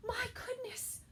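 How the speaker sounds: high shocked mother's gasp and cry; gasp, then panic high; startled gasp. Acted. Sal's mother discovering a bear, gasping high. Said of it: "turned around and gasped".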